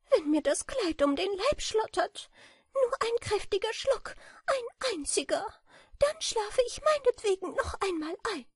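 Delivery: high, thin voice, a touch worn out; weary little bat. A narrator's reading. Bat tells the beetle her fat store is gone, high and tired. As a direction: sound small and matt after winter.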